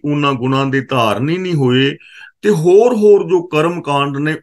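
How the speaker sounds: normal talking voice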